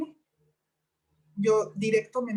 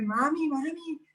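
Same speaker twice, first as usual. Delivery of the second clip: put-on child calling; 6 semitones higher, slower than usual. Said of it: "la niña empezó a gritar".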